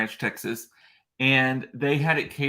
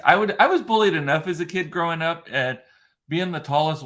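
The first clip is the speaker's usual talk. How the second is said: ordinary talk, nothing marked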